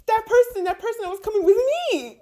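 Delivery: squeaky voice, a touch of pleading; high pleading voice. Retold or imitated. Stranded you asking God about the food, high and pleading. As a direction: plead like a lost kid. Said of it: "And you're like".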